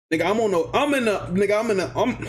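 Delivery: stumbling over repeated false starts; stuttering. Real, not acted. Storyteller stumbling as he picks his tale back up, stuttering. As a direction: trip on the words and keep restarting. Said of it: "bruh, I'm stuttering".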